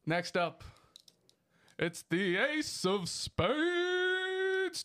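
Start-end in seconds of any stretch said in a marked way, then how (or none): 1.8-4.8 high, very slow announcer drawl; announcer intro. Acted. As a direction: stretch the name out like a ring announcer. Drawn out introduction.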